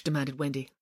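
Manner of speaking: plain reading voice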